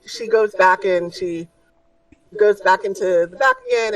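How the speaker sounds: usual speaking voice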